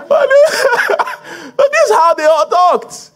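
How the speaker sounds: high, helpless laughing speech; talking through laughter. Real, not acted. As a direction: squeeze the words out between laughs. Still laughing at own joke.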